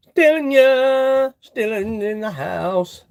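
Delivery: "Stealing" drawn out, slow and high; slow mock announcement. Mock announcing a chat viewer's name, slow and drawn out. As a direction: stretch every word like a ring announcer.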